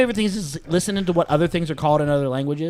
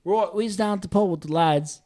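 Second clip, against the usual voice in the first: hammed-up laddish English accent; 4 semitones higher than usual. English lad telling of a night out, English accent. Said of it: "whenever you're in England".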